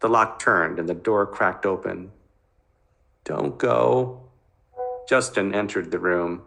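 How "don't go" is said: put-on pleading little boy. Frightened little brother pleads.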